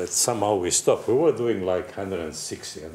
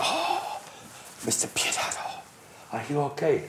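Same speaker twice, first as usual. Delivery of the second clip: hushed mock whisper; 3 semitones higher, 8 dB quieter than usual. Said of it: "So I said".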